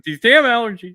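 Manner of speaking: high, loud, joking fake excuse; mock excuse. Hiding tears from cohosts, blames allergies in a high loud mock voice. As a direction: brush the tears off as allergies, playfully. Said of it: "I'm like".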